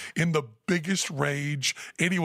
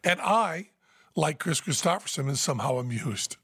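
ordinary talk

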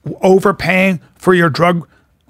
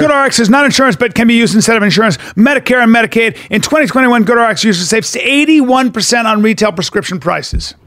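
higher-pitched, driving sponsor read; ad disclaimer; 5 semitones higher than usual. Real, not acted. Co-host giving listeners the GoodRx fine print, ad read. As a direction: rattle off the small print with energy.

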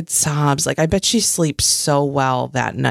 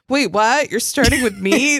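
higher, mock-startled question; startled protest; 6 semitones higher than usual. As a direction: imitate someone put on the spot. Retells her startled reply to game.

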